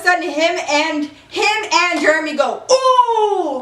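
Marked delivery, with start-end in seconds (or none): 2.7-3.6 high, drawn-out onlookers' "ooh"; shocked "ooh". Retold or imitated. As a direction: imitate two men reacting to the footage. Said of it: "like that".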